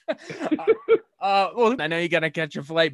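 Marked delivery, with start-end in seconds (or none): 0.1-1.7 after laughing hard. Real, not acted.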